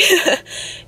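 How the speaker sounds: breathy teary laugh while crying. Real, not acted. Laughing through tears, telling viewers about ultrasound. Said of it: "started crying".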